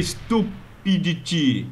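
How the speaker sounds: slow, drawn-out mock voice. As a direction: stretch the word out in a silly voice. Repeats the word in mock voice. (voz caricata lenta)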